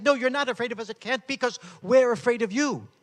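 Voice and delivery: loud, high-pitched rebuttal; heated reply. Retold or imitated. Jamal insists to the speaker, loud and high. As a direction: throw the accusation straight back.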